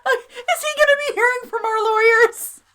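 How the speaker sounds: high, squeaky voice through laughter; choked with laughter. Real, not acted. Co-host reacting to the outrageous insult, choked with laughter. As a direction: struggle to get it out while cracking up. Cracking up over witch and bitch.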